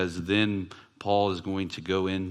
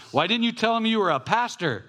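high-pitched imitation of a scolding child; mock-exasperated, pitched way up; disappointed daughter; 13 semitones higher, 4 dB louder than usual. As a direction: mimic a little girl reproaching her dad. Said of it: "I'm disappointed in you".

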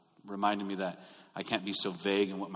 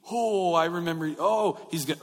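mock dawning alarm, pitched up; imagined shock; 6 semitones higher than usual. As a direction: play the brothers' shocked realization. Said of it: "Like the shock".

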